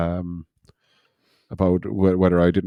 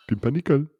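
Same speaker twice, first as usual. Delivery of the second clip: higher-pitched mock French accent; 7 semitones higher than usual. Shown as a demonstration. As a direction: try a dodgy French accent. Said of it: "say it in a French accent".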